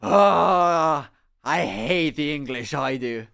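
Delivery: put-on loud, drunken Irish accent; drunk Irish guy. Drunk Irish guy at the pub, in a drunken Irish accent. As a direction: imitate a drunk Irish man ranting. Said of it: "And he's just like".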